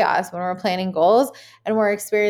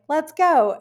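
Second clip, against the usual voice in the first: higher, bright pep-up call; mock hype; 8 semitones higher, 3 dB louder than usual. Imitating herself gearing up for a new goal, mock hype. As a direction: pump yourself up for the goal. Pumped self-talk at the start.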